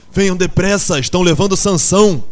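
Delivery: loud, high-pitched alarm; urgent shout. A narrator's reading. Benjamin warns that Sansão is being taken, shouting.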